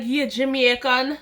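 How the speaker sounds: put-on slow, playful Jamaican accent; put-on Jamaican-accented question. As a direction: drag it out in mock Jamaican. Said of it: "I was like".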